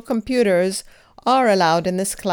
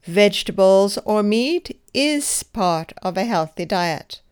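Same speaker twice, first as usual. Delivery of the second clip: "is" said with a clear push of stress. Shown as a demonstration. Teacher gives the correct answer, stressing "is". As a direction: lean on "is", the verb. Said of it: "Emphasize the verb as I did".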